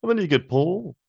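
high [name] voice. Acted. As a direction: switch up to [name]. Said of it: "[name]'s".

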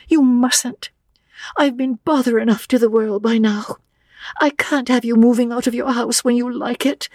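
put-on high, stammering young woman; nervous girl tripping over her words; young Lucy, flustered. A narrator's reading. Lucy protesting to old Mr Emerson, flustered and high.

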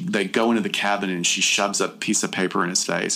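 ordinary talk

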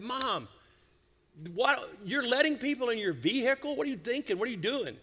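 mock-alarmed son scolding his mom; incredulous scolding. Retells scolding his mother in disbelief.

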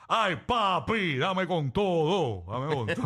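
slow, drawn-out crooning tease; mock reggaeton singer. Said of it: "ahora estos machos cantando en la música urbana".

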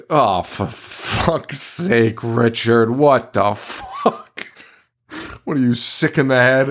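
slow, fed-up fatherly groan of disbelief; exasperated dad. Retold or imitated. Roddy scolding Richard over the monkey, exasperated dad voice. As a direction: drag it out like a dad who's had it. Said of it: "He talks like Joe's dad to Joe".

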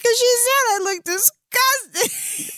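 very high, slow mock wail; imitated bawling. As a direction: imitate a patient sobbing out a hurt complaint. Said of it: "then she starts bawling".

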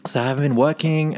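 ordinary delivery

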